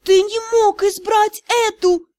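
anguished moan. A narrator's reading. Rejected lady moaning at the lord, pained wail. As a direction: moan it out in despair. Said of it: "простонала она".

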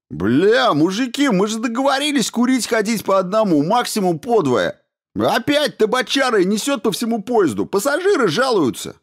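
very high, squealing complaint; whiny squeal. A narrator's reading. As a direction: whine like a petty official. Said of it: "милиционер не начал тихо визжать".

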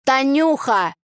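put-on high, slow drunken pleading; Tolik's voice. Tolik answering Tanya, high and slow. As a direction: act the drunk friend. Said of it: "А он мне".

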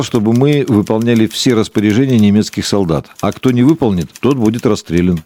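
normal talking voice

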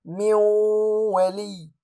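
من ولي with the nun merged into waw and held nasal; nasalized merge. Shown as a demonstration. Teacher reciting the correct merged nun. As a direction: recite من ولي with two-beat ghunna. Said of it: "ولا أقول".

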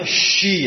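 "shi" with the tongue against the palate; wrong sh sound. Shown as a demonstration. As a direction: slip from sad into a hushing "shi".

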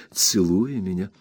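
plain reading voice

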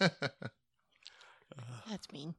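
шёпотом: «That's mean»; hushed, breathy whisper; whispered aside. Real, not acted. Teasing the wife story.

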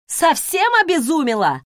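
angry growl. Acted. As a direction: snarl it in disbelief. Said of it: "рыкнула Эбигейл".

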